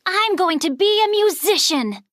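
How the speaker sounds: bright-eyed vow; determined boy. A narrator's reading. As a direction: proclaim it with a boy's resolve. Miguel declares his dream.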